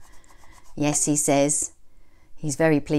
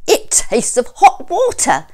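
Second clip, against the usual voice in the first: loud, high, disgusted; disgusted wolf; 11 semitones higher, 9 dB louder than usual. A narrator's reading. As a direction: complain with the taste still there. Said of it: "he said as he spat it out".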